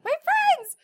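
put-on high, loud squeaky little girl voice; little girl bursting in on a game, cartoon-high and loud. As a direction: imitate a tiny cartoon girl squealing with joy. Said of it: "it sounded like a little cartoon girl".

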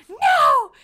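high, loud stubborn shout; defiant child shouting back, loud high no; toddler yelling no. Retold or imitated. Yelling match over cracker.

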